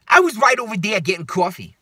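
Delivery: loud, high mock New York whine; hammed-up New York woman; New Yorker accent. Woman insisting she was getting coffee, exaggerated New York accent. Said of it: "like, New Yorker accent".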